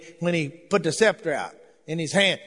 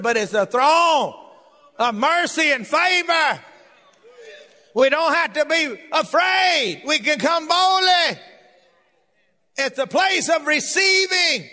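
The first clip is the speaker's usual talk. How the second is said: full-force preaching; 7 semitones higher than usual. Acted. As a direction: preach it out to the whole church.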